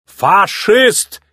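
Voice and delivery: high-pitched put-on cry; shouted accusation. A narrator's reading. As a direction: cry it out as an insult. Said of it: "крикнул Филмор".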